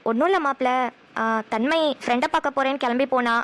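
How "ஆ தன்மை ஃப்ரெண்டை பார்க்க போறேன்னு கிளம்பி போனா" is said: usual speaking voice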